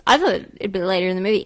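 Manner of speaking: normal talking voice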